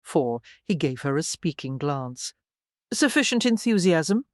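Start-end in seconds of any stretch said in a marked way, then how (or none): 2.9-4.2 sharp, acid echo, louder and higher; acid repeat. A narrator's reading. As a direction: spit the words back acidly. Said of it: "he repeated acidly".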